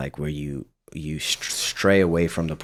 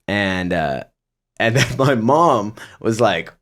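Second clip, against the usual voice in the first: ordinary talk, nothing marked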